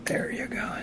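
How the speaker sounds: soft whisper to himself. Real, not acted. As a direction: breathe it out under your breath. Fiddling with stiff switch.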